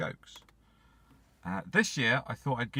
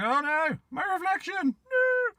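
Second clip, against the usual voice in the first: much higher, louder mock wail of panic; hammed-up despair; 4 dB louder, slower than usual. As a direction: shriek it like a drama queen losing his face. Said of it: "he's like".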